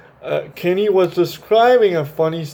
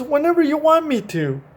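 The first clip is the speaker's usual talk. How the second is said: the horse answering; 11 semitones higher than usual. Acted. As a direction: answer up high and gently. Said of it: "He answers".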